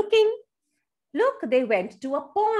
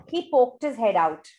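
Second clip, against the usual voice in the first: usual speaking voice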